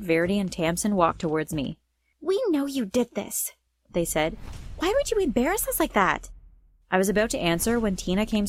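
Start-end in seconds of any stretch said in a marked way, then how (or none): 2.2-3.5 high-pitched accusation; indignant, voice pushed up high; accusing. A narrator's reading. Pranked girls confront the narrator, accusing. 4.8-6.3 much higher-pitched, aggrieved question; indignant. A narrator's reading. Embarrassed girls confront the narrator, indignant.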